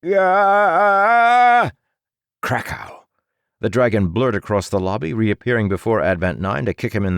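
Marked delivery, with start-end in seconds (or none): 0.0-1.7 high, loud startled gah; shocked cry. A narrator's reading. The copy cries out seeing the hero's bare face. Copy pointing, eyes shaking. 2.4-3.0 low punchy krakow; a low crash for the blow; impact crash. A narrator's reading. Narrator voices the dragon's kick landing.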